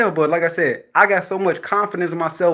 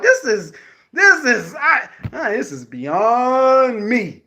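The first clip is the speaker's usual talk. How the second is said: normal talking voice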